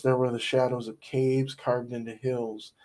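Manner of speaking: usual speaking voice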